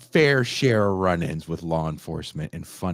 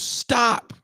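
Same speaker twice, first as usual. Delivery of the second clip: high-pitched mock cop yell; imitated yell. Cop ordering a walker to halt, imitated yell. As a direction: yell it like a cop halting someone. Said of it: "and he yells".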